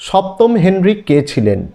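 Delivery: ordinary delivery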